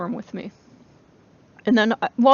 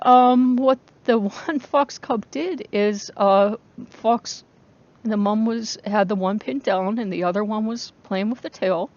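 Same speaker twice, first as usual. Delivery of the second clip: ordinary talk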